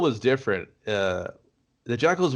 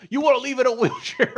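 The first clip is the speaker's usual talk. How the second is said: mock tough guy; 12 semitones higher than usual. Green Arrow threat impression.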